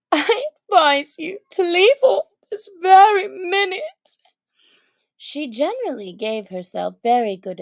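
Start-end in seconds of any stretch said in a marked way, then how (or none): 0.1-4.2 high, loud self-scolding; sharp scolding. Acted. Alice scolding herself for crying, sharp and loud. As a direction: snap at yourself to stop crying. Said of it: "rather sharply".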